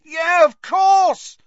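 high, drawn-out cry of realisation; loud. A narrator's reading. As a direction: burst out as it all clicks. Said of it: "said Harry loudly".

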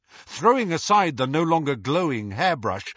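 ordinary delivery

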